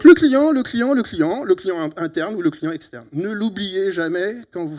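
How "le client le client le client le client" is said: emphatic call. Real, not acted. Hammering home the key word.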